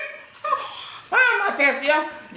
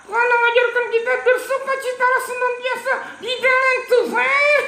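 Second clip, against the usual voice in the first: high, laughing puppet shout; excited puppet; 11 semitones higher than usual. Acted. Puppet shouts the verse to the children, laughing. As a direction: belt the verse out gleefully as the puppet.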